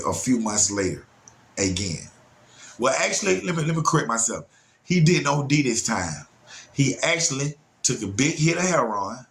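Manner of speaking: ordinary talk, nothing marked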